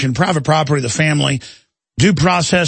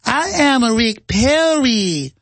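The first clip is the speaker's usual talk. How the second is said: much higher, slow, over-enunciated self-introduction; Rick Perry impression; 6 semitones higher, much slower than usual. Host mocking a governor introducing himself, high slow pandering. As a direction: pander slowly like a clueless politician. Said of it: "went out to California and went".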